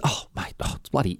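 hushed, breathy mumble of a man caught out; caught-out whisper. Retold or imitated. Gerard caught by the guard, answers in a startled whisper. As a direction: whisper it like you've been caught skulking.

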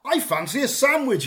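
high, hammed-up story character; perky theatrical self-talk; musing young go-getter. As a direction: pitch it up like a character in a tale. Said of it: "he says to himself".

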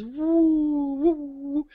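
loud mock wailing woo; rising, loud imitated woo; imitated woo sound. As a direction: hoot out the eerie woo noise. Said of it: "it just goes".

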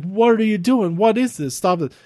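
mock-frantic plea to stop; Jimmy pleading. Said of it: "He's like".

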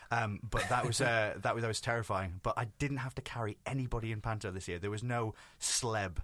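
ordinary talk

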